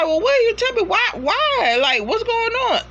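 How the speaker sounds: high, pleading woman's voice asking what's wrong; worried woman. Retold or imitated. Wife quizzing her husband, high and anxious. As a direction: press him for answers in a high worried voice. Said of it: "she like".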